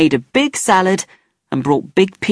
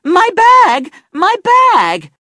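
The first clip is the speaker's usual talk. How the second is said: panicked high shout, much louder; 5 dB louder, much slower than usual. A narrator's reading. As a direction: scream it out as if robbed. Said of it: "she shouted".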